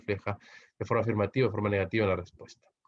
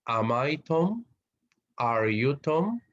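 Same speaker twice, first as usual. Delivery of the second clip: slow rising English question; teacher modelling a question; question intonation; 3 semitones higher, much slower than usual. Shown as a demonstration. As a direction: lift the end into a question.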